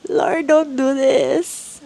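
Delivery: pleading prayer. Retold or imitated. Retold desperate prayer.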